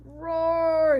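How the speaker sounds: a lion roaring at the stranger; hollered lion roar. Acted. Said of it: "he hollered".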